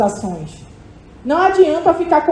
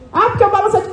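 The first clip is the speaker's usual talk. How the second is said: higher mock complaint; complaining resident; 7 semitones higher, faster than usual. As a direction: mimic a griping resident, pitched up. Mimics a resident starting a complaint.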